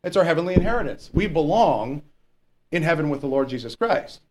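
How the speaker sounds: ordinary talk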